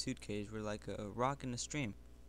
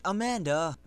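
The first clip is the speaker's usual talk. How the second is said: calling out; 5 semitones higher, 9 dB louder than usual. Acted. Calling after her as she runs.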